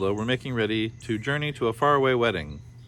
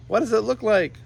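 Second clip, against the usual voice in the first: high, louder squeaky retort; tiny brother voice; 10 semitones higher, 4 dB louder than usual. A narrator's reading. Shrunken Yorick snapping at his brother, high and squeaky. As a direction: snap back in a tiny high voice.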